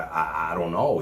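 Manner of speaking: ordinary delivery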